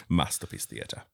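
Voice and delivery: low, soft faux-British announcer accent; Masterpiece Theater accent. Host showing off his voice to co-host, Masterpiece Theater accent. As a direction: say it in a hushed mock-British accent. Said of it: "it's just my Masterpiece Theater accent".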